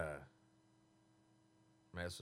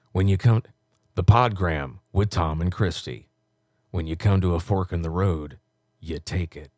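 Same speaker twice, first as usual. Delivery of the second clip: low, punchy promo read; low-pitched radio imaging voice; radio announcer; 11 semitones lower, 7 dB louder than usual. Acted. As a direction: deliver it like a station liner. Records promo liners in announcer voice.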